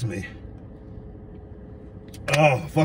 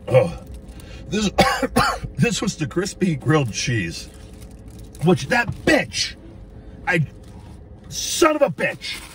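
coughing up water; 4 semitones higher, slower than usual. Real, not acted. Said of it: "It went down the wrong pipe".

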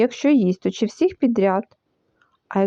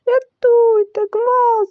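high wail for help; panicked, winded cry; 10 semitones higher, 4 dB louder, much slower than usual. A narrator's reading. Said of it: "який так захекався". (захеканий переляканий крик)

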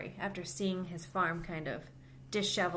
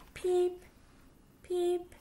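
high squeaky little duckling peeps; baby duck peeping; 10 semitones higher, 6 dB louder than usual. Acted. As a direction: chirp it out high like a tiny duck. Baby duck's peeps in story.

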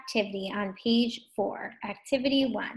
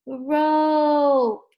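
ROPE with the long O held out, louder; stretched vowel; 4 semitones higher, 7 dB louder than usual. Shown as a demonstration. Teacher showing the long O sound, ROPE stretched loudly.

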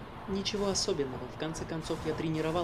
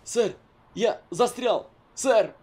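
high strained pleading; faking being stuck; 8 semitones higher, 7 dB louder, much slower than usual. Pretend. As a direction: whine as if wedged tight. Soldier fakes being stuck.

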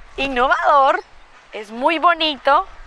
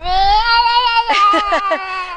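high delighted laugh; 7 semitones higher, 4 dB louder than usual. Real, not acted. Grandmother laughs with her grandson, a high delighted laugh.

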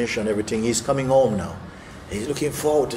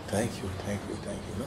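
very soft, almost whispered gratitude; hushed thanks; 3 semitones lower, 13 dB quieter than usual. Retold or imitated. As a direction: murmur thanks overwhelmed. Widow grateful, very soft.

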